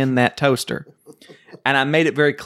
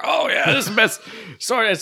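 high excited imitation of dad; mock-delighted outburst, pitched up; dad's gleeful cheer; 9 semitones higher than usual. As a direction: gush like a thrilled dad. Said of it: "my dad's like".

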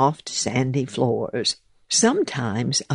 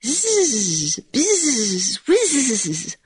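high, buzzing little bee; peevish squeak; 11 semitones higher, much slower than usual. Acted. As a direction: squeak the buzz out crossly. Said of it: "in a peevish squeak".

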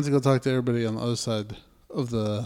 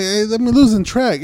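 mock exasperation; 9 semitones higher, 8 dB louder than usual. Retold exasperation continues.

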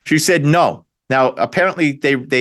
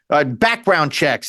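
usual speaking voice

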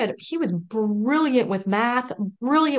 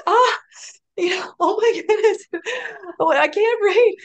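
crying through it, higher and louder; real tears; 10 semitones higher, 5 dB louder than usual. Real, not acted. Recalling she couldn't read, breaking into tears.

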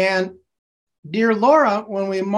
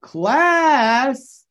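loud, higher call to the class; 5 semitones higher, 3 dB louder than usual. Shown as a demonstration. Teacher opens the game by calling class, loud. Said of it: "And here we go".

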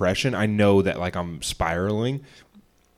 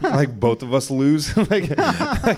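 laughing through the words, high and slow; 6 semitones higher, 4 dB louder, slower than usual. Real, not acted. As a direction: crack up at your own story. Laughing at the silly fight.

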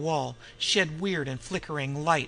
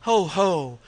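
mad laughter; 3 semitones higher, 7 dB louder than usual. Acted.

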